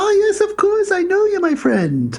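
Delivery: very high, stretched-out mock-African accent; crazy African accent. Exchange student greeting the Jamaican, crazy African accent. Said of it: "he's putting on this crazy African accent".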